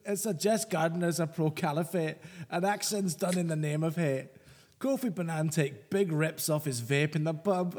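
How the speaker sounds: hammed-up Scottish accent; mock Scottish accent. Co-host reading a listener's hate poem, mock Scottish accent. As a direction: read it out in a broad joke Scottish accent. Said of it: "offensive Scottish accent now".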